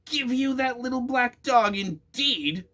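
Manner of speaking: high loud outraged witch cry; indignant witch. A narrator's reading. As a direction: scoff at the demand like an outraged witch. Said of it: "cried Grimalda indignantly".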